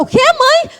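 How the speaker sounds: very high, startled "O quê, mãe?"; shocked question. Retold or imitated. Daughter on the bumpy plane, very high disbelieving question. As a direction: squeak it out in disbelief. Panicked daughter asks again.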